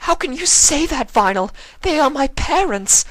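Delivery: furious disbelief; angry outburst. Acted. Octavia angrily challenging Vinyl about her parents. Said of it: "Octavia was becoming angered again".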